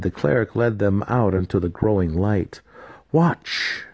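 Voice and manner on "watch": high, clipped grim order; grim command. A narrator's reading. Cleric commanding raiders to watch, grim. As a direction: command them to look. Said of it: "was her grim command".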